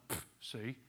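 mock-smug wife with a scoffing puff; lightly voiced smug wife; smug told-you-so. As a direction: gloat at the husband. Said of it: "looking at your husband and going".

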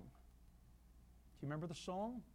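Appalachian hillbilly twang; hillbilly accent. Pretend. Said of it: "I'm even doing it with a hillbilly accent".

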